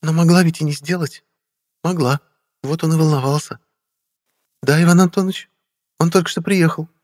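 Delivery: высокий женский голос в диалоге; сообщи тонким, заметно поднятым голосом; Вера. A narrator's reading. Narrator voices Vera in dialogue. (женская реплика в высоком регистре)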